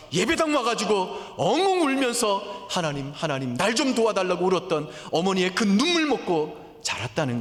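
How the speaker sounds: 높고 크게 외치듯 설교; 목소리를 높여 절박하게 호소하기; impassioned preaching. Acted. Preaching climax. (열정적으로 외치는 설교)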